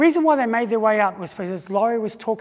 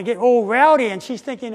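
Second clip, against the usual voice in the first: ordinary talk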